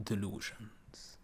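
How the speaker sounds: "delusions" drawn out slowly, set apart by a pause; pointed, slow "delusions", standing on its own; emphasis on "delusions". A narrator's reading. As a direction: stretch the word "delusions" for weight. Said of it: "he emphasized the word".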